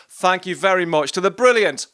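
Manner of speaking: host hyping the singer to the audience, big and bright; rousing announcement. Real, not acted. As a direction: announce her name to the room like a compère. Host closing, announcing the guest.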